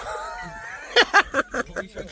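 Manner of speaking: high, breathless laugh without voice; helpless silent laugh; wheezing laugh. Real, not acted. Laughs at Zenek story.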